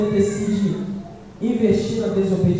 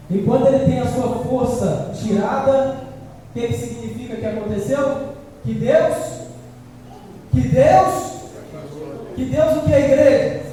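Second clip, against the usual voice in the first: fiery preaching; 3 semitones higher than usual. Acted. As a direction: preach it hard at the congregation. Preacher climaxes on God leaving.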